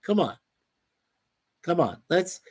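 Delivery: ordinary talk, nothing marked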